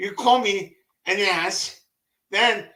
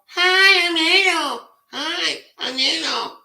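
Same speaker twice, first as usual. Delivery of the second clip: high-pitched mock woman's voice, loud at first; put-on high feminine introduction, easing in volume; 9 semitones higher, 3 dB louder than usual. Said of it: "Ado sounds like a freaking woman".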